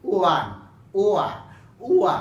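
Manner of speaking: isolating the w sound of ওয়ান; sounding out one. Shown as a demonstration. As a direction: stretch ওয়ান into ওয়া, ওয়া to show the w sound. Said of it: "আপনি এখানে উচ্চারণ করবেন".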